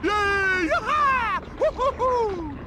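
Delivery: very high, gleeful whoops; jubilant cheering. Acted. Friends celebrate victory, high-pitched whooping. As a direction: cheer wildly in a squeaky pitch.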